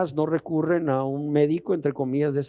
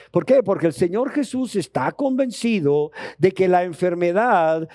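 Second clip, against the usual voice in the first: louder, higher preacher's build to a climax; fervent preaching; 5 semitones higher, 3 dB louder than usual. Acted. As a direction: drive the point home from the pulpit. Preaching rises to full force.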